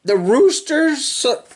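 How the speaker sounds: lively sing-song storybook reading; reading to children. Acted. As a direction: read the rhyme to little kids with bounce. Reading picture book to children.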